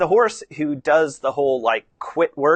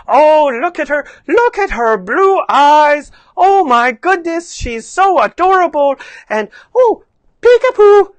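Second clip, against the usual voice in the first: very high, loud, gushing cooing; hammed-up squealing over a baby; animated baby talk; 10 semitones higher, 10 dB louder than usual. Adult meeting baby in stroller, gushing baby talk. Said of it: "you get, like, animated".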